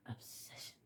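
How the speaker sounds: hushed, voiceless whisper of one word. Real, not acted. Naming why they cannot stop, whispered. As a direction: drop right down and whisper it.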